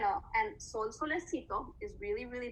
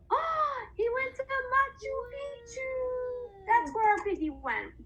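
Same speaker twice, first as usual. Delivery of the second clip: excited discovery; 12 semitones higher, 4 dB louder, slower than usual. Acted. Asking where the piggy went.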